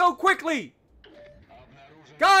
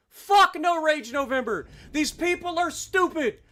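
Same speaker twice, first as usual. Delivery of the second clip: ordinary talk, nothing marked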